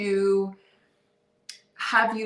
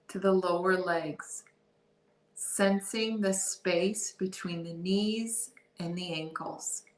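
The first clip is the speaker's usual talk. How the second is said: calm, softer body-scan voice with long pauses; guided meditation; 3 dB quieter than usual. Real, not acted. Guide leading listeners through a body scan, guided meditation. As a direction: ease them into awareness, unhurried and soft.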